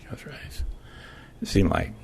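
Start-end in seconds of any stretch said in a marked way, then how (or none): 0.0-0.6 hushed whisper dwelling on the moment. A narrator's reading. Repeated softly for effect.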